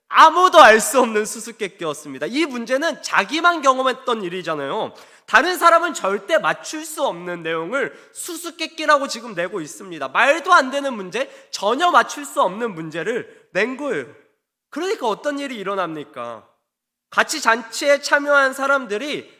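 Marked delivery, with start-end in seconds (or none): none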